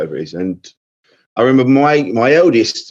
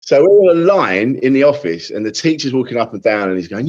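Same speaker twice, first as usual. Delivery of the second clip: ordinary talk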